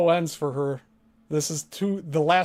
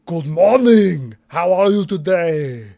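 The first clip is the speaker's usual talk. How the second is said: slow, louder put-on greeting voice; a put-on polite greeting voice; voice imitation demo; 6 dB louder, slower than usual. Shown as a demonstration. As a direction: show off an acted greeting, drawn out. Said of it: "I love doing imitations of people".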